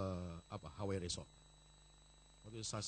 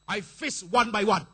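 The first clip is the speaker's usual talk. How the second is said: slow, loud, high proclamation; loud declaration; 9 semitones higher, 21 dB louder, slower than usual. Shown as a demonstration. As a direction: declare it to God with full force. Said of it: "Kasih tahu dia".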